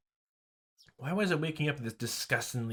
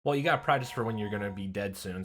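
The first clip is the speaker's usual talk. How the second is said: ordinary delivery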